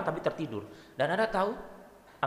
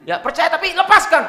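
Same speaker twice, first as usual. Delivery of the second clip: put-on high, loud Batak-accented laughing plea; 14 semitones higher, 13 dB louder than usual. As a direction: beg God in a Batak accent. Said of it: "gaya Batak ini ngomongnya". (memohon dengan logat Batak sambil tertawa)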